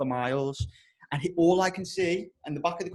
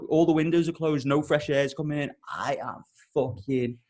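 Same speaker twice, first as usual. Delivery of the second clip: ordinary delivery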